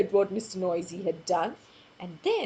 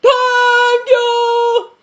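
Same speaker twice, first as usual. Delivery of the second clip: huge, loud, high sung thank you; booming thank you; 12 semitones higher, 15 dB louder than usual. Acted. Mister Noisy booming thanks at the baker. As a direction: bellow it like a giant. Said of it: "you know how its thank you would sound".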